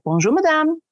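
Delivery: "Bonjour, madame" lifted high and courteous; polite French greeting. Shown as a demonstration. Teaching Americans the French shop greeting, lifted polite. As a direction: sing out "Bonjour, madame" brightly upward. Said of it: "that one little trick".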